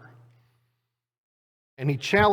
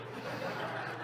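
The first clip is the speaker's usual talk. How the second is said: soft laugh; 14 dB quieter than usual. Real, not acted. Laughing at own story.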